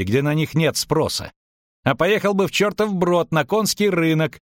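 high-pitched, argumentative character voice; indignant merchant halfling. A narrator's reading. Merchant sets out to disprove the witcher, indignant.

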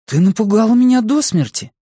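high-pitched startled protest; acted fright in a high voice. A narrator's reading. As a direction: react to a fright, voice jumping up. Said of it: "заставил её вздрогнуть".

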